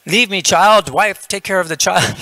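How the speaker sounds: high, loud, flustered yogi; mock exasperated outburst. Yogi sending the child to his wife, exasperated. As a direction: shoo them away in comic exasperation.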